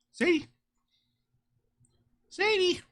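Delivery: high sing-song call to the dog. Real, not acted. Calling the dog in a high cooing voice. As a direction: coo her name up high.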